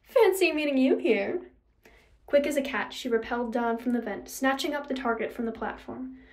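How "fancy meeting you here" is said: higher, louder teasing purr; mock-pleased rival's purr. A narrator's reading. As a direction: purr it at your arch nemesis. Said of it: "his arch nemesis purred".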